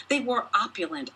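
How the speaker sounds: ordinary delivery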